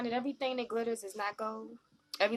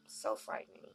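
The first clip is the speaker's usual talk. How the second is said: soft, breathy aside; whispered so frightening; 13 semitones lower, 6 dB quieter than usual. Real, not acted. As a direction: murmur it as if scared. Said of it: "whispered aside".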